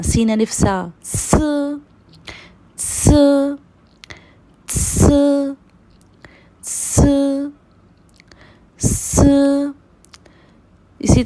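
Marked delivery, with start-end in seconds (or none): 1.0-9.7 स hissed like सीन; pinyin s sound. Shown as a demonstration. Teacher drilling pinyin s as सीन अलिफ सा. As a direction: hiss a clean सीन-like स. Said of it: "pronounce करते हैं सीन की तरह".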